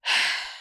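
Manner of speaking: long breathy sigh; weary sigh. Retold or imitated. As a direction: let out a sigh before bad news. Opens box, expects damage.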